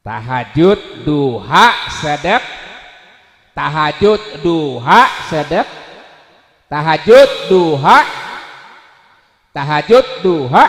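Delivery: loud, each word swooping up; punched call with rising ends; slogan drill. Shown as a demonstration. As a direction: lead the crowd word by word.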